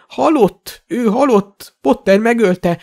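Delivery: high, insistent frightened voice; panicked stammer. A narrator's reading. Shopkeeper insisting the dark lord is dead, panicked. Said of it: "dadogott Borgin".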